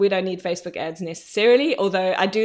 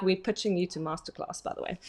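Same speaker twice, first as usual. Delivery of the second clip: hushed aside; 2 semitones lower, 9 dB quieter than usual. Retold or imitated. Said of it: "I'm like".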